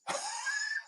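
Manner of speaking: high airy laugh at himself; giggle, amused at his own joke; short high unvoiced giggle; breathy giggle. Real, not acted.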